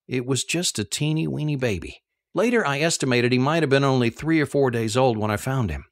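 plain reading voice

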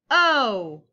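sudden loud letter call. Acted. Calling out letters in game.

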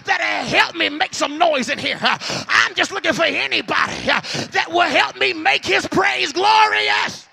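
loud revival preacher rallying the crowd; fired-up church exhortation; full-force preaching. Real, not acted. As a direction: drive each phrase hard, punched with gasped "uh".

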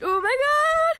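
loud high yelp of shock; shocked cry. Real, not acted. Sudden fright outburst.

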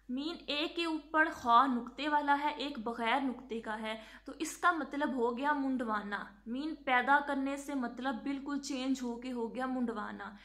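ordinary talk

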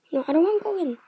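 high, loud refusal; loud, high-pitched objection; alarmed protest. Real, not acted. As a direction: protest in a rising panic. Resists being pulled in game.

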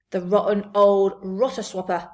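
indignant cry. A narrator's reading. BFG hears of Sophie's punishment, indignant cry. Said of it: "cried the B F G".

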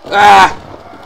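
short, loud, high yell; gamer's scream. Real, not acted. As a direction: yell as the attempt goes wrong. Scream as attempt fails.

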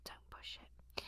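whispered warning; hushed aside. Pretend. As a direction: whisper it under your breath. Muttered aside after the accent bit.

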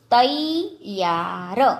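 તૈયાર very slowly, syllable by syllable; slow word demonstration. Shown as a demonstration. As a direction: stretch out every sound of તૈયાર. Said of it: "જોડણી ઉપર જોજો".

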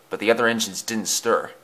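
ordinary delivery, nothing marked

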